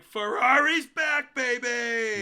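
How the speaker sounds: loud, slow, high hype; hyped cheer. Retold or imitated. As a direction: cheer it like a fan. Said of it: "we're all like".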